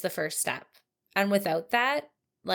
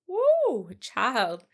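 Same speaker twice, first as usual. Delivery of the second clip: incredulous woo; 4 semitones higher, 6 dB louder than usual. Real, not acted. Host reacting to the killer blaming the media, a loud incredulous whoop. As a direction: let out a disbelieving whoop.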